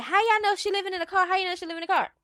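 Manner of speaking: high, mocking imitation of fans; mimicked fans. Mimics the rival's defensive fans.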